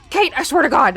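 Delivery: hammed-up exasperation; imitating exasperated friend. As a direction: play him at the end of his rope. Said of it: "And he's like".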